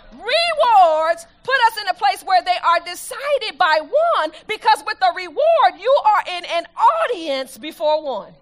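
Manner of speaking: loud, high, driving sermon delivery; full-force preaching. Acted. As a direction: preach it out to the congregation, building.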